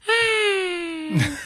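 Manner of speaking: tiny high-pitched distant wail; thin, high keening, kept soft; faint ghostly wail. Retold or imitated. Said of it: "a very faint".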